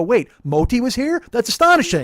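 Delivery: loud, high-pitched mock astonishment; hammed-up disbelief, rising in pitch and volume; mock amazement. Riders marvel at a window drawing, mock amazed.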